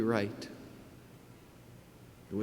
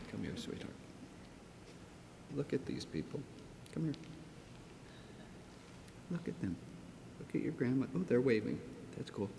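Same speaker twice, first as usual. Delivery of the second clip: much softer, tender and close; 13 dB quieter, faster than usual. Real, not acted. Tenderly calling the child forward.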